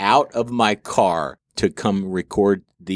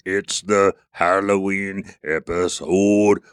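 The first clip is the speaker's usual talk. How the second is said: slow, drawn-out Halloween host voice; a hint of a horror movie host; spooky announcer voice; slower than usual. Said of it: "Now I'm going into Dracula mode".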